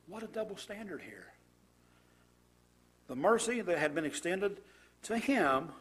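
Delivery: ordinary talk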